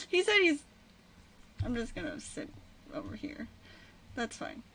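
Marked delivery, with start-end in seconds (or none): 1.6-4.6 soft sheepish mumble after rejection; mumble, embarrassed and much softer; quiet mumble. Real, not acted. Rejected, retreats muttering.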